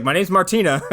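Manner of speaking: retold annoyed housekeeper; indignant correction. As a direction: correct her name with mock offence. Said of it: "She's like".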